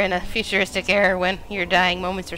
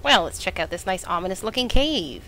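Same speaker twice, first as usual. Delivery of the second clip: ordinary talk, nothing marked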